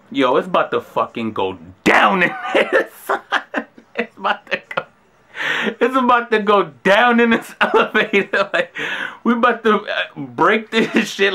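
words broken by real laughter; can't hold back the giggles; laughing while telling. Real, not acted. Laughing at the elevator memory.

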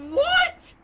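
very high, loud squawk of shock; real incredulous shriek; shrieked disbelief. Real, not acted. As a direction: shoot right up into a squeal. Disbelief at game.

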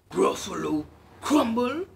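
a beast aghast at the threat; horrified Gruffalo. Acted. Gruffalo repeats the mouse's words, loud horrified echo.

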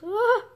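high, loud mock-horrified "ah"; shocked gasp. Gamer reacting to villain's diary, mock-horrified cry. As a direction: cry out as if traumatised.

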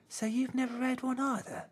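a puzzled little bear; a shy little bear, puzzled by the hammer; little teddy bear. A narrator's reading. Green asks softly.

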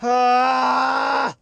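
long, high cry of exhaustion; screaming from effort. Real, not acted. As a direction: let out the strain in one held cry. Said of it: "I was screaming just to get through it".

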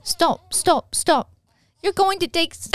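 Hermione. Pretend. Attempting a film line for friends, British accent. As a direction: put on a high British schoolgirl accent. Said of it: "just channel Hermione".

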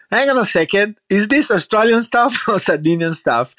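high-pitched puzzled challenge with a laugh; incredulous tourists. Retold or imitated. Retold tourists doubting product's origin.

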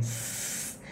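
S hissed with a wide smile; smiling s. Shown as a demonstration. Teacher contrasting the smiling S for learners. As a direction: spread the lips and hiss S. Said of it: "cười lên".

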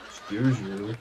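low, dry loudspeaker reply. Retold or imitated. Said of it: "what comes out of the loudspeaker".

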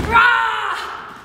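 loud battle cry rah; shout. Shown as a demonstration. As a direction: yell it out with energy. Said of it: "Let me hear ya".

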